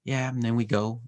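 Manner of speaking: usual speaking voice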